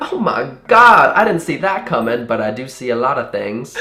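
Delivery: mock Southern lady amazement; Southern accent. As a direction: imitate an astonished Southern lady. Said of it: "really bubbly and talkative and Southern".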